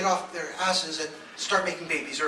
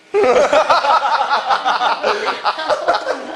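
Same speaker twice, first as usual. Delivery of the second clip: high, loud laughing fit; long loud laugh; 8 semitones higher, 13 dB louder than usual. Real, not acted. Comic laughing hard at his own joke. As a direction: let the laugh roll on.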